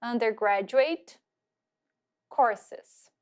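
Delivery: slow "Undergraduate courses" with pauses; careful repeat drill; model pronunciation. Shown as a demonstration. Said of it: "Vão repetindo aí".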